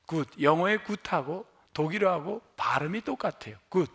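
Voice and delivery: ordinary talk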